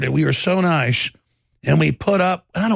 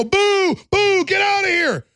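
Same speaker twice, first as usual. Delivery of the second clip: jeering heckler; slower than usual. Retold or imitated. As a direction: shout boo at him like a hostile stranger in the street. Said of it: "They go".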